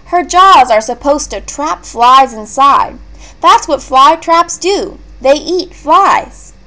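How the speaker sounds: put-on a little boy insisting on the rules; boy telling how fly traps work; young boy Mason.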